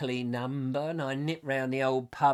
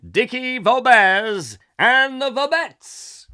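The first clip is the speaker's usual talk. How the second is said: slow, booming and much higher, like a compere; grand announcement; 8 semitones higher, 9 dB louder, much slower than usual. Pretend. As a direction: stretch each word out to the back row. Mock stage announcer introducing act.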